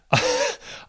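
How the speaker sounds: short high-pitched laugh. Real, not acted. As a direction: burst out laughing mid-story. Host laughs reading listener's disaster.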